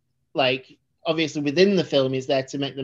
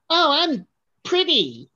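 high, drawn-out cartoon impression; Johnny Tightlips; 11 semitones higher, slower than usual. Co-host teased for slipping into a cartoon voice, impression. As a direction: put on a slow, high-pitched caricature. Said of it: "are you doing Johnny Tightlips again".

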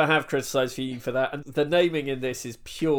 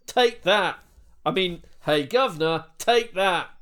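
higher and louder, hammed-up punch shout; mock battle cry; 5 semitones higher, 5 dB louder than usual. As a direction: land the blow with a comic yell. Throwing a punch in sketch.

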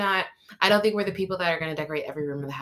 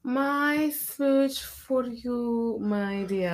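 slow, tender endearment; 6 semitones higher, much slower than usual. Real, not acted. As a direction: draw it out sweetly for a partner. Affectionate podcast sign-off.

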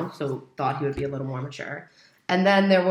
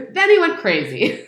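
higher, louder, breaking in with a laugh; 9 semitones higher, 8 dB louder than usual. Real, not acted. Telling friends how he went crazy, laughing. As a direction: laugh as you reveal the twist.

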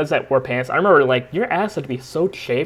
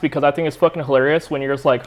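usual speaking voice

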